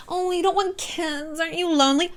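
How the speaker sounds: high, soft, cooing fake concern; put-on high voice, softly prying; mock concerned busybody. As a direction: imitate a pitying nosy questioner. Mimics people nagging her about kids.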